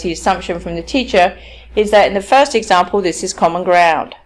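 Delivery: ordinary talk, nothing marked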